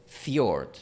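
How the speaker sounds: "fjord" en inglés, con fj seguido y sin vocal añadida; palabra inglesa. Shown as a demonstration. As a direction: pronuncia "fjord" a la inglesa, en una sílaba. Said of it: "en la palabra inglesa". ("fjord" dicho como en inglés)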